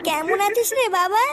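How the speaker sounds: ordinary talk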